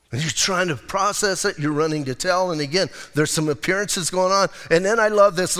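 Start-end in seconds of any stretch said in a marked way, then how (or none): none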